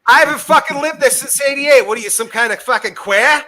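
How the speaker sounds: put-on loud, high-pitched Boston accent; hammed-up Boston tough talk. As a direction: heckle like an incredulous Bostonian. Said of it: "I'm reading this in a Boston ac".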